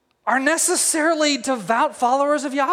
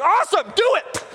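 high, punched, hammed-up command; eager king; 9 semitones higher than usual. King hears of ready gallows, eager high command. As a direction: pitch it up with mock eagerness. King gleefully orders the hanging.

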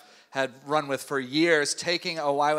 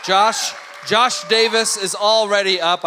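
loud, raised host's call; hyped-up introduction; 5 semitones higher, 8 dB louder than usual. Pretend. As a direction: call the next guest up with showy volume. Host hypes up next guest.

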